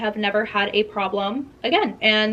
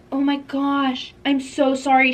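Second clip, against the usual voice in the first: fake apology; 3 semitones higher, slower than usual. Drunk friend after puking on shoes.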